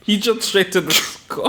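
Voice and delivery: real laugh bubbling up mid-sentence; amused laughing. Real, not acted. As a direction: laugh through the last words. Co-host amused by abrupt rating.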